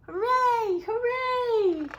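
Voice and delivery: high, loud joyful cheer. Acted. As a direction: cheer with all your might. Animals cheer.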